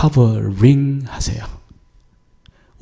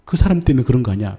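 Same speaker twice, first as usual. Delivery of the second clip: brisk rebuke; faster than usual. Retold or imitated. God's rebuke quote, rapid.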